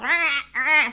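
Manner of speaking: mock the grinding of the dented truck door; creaking door. Retelling how the husband's dented door sounds, screeching. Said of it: "and it goes".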